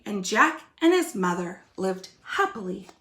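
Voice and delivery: put-on lively storybook reading for kids; reading to children. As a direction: read it like a picture book to little ones. Teacher reads story aloud.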